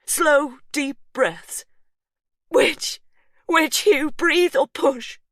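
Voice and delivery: high, loud and slow, frantic; panicked couple shouting during birth. A narrator's reading. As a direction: shout in confusion. Panicked exchange in labour.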